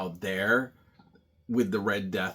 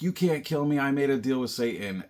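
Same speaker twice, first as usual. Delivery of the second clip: higher, louder retold protest; mock defiant protest; 5 semitones higher, 4 dB louder than usual. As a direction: lightly act out an indignant villain. Retelling the villain's defiant protest.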